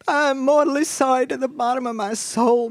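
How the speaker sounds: tearful apology. A narrator's reading. Mama begs forgiveness from Miss Judith Rose, tearful. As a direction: wail the apology, lightly tearful. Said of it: "wiping off tears".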